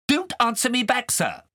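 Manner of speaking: scolding, high and sharp; cross governess. Acted. Governess scolding Kay over pork pie, cross. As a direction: tell him off like a strict governess.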